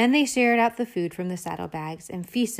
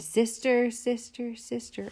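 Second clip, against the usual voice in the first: high echo dying away, softer each time; cave echo; 4 semitones higher, 4 dB quieter, slower than usual. Acted. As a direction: echo of the call, fading out. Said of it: "a voice from the cave came back".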